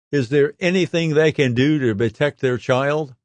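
normal talking voice

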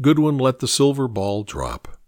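usual speaking voice

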